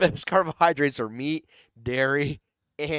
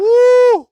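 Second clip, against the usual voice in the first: high-pitched, very loud "woo!"; loud whoop; 19 dB louder than usual. Acted. Co-host reacting to imagined surgery mistake, loud high whoop.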